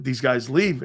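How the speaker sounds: ordinary talk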